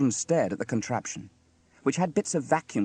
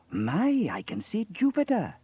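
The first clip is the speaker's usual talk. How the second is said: high exclamation after a gasp; amazed; 10 semitones higher, 4 dB louder than usual. A narrator's reading. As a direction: marvel at what you see. Sam amazed through telescope.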